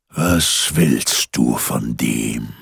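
low, slow, soothing singsong; soft singsong. A narrator's reading. Giant suddenly sweetens toward Peter, soft singsong. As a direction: coax him gently, drawing the words out. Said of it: "viel sanfter mit singender Stimme".

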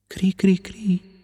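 slow, high whispered cri cri cri; cricket chirp. A narrator's reading. Hidden cricket chirping at Pinocchio, slow whispered cri cri cri. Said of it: "qualcuno che fece".

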